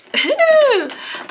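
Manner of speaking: loud, high burst of laughter. Real, not acted. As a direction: let a high laugh ring out.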